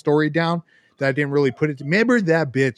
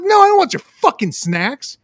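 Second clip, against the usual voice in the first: annoyed retort; 11 semitones higher, 3 dB louder than usual. Retold or imitated. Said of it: "I'm like".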